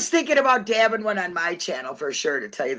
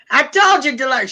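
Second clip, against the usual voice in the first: high, loud mock New York accent; 7 semitones higher, 4 dB louder than usual. Daughter joking as Dolores's pal, imitated New York accent. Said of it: "She's talking in New York accent".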